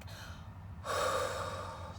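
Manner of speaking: a deep, audible exhale; long breathing sigh. Shown as a demonstration. Reader models breathing for the anxious Mother of Wands, long exhale. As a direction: breathe out slowly, letting it go. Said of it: "breathe through it".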